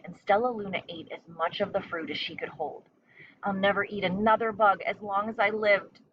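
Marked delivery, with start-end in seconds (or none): none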